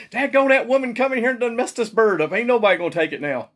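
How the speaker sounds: laughing, high hillbilly grumble; mock customer. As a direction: play a fed-up country customer. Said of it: "people in there be saying".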